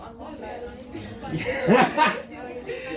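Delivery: amused rising laugh. Real, not acted. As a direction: burst out laughing. Laughing at own question.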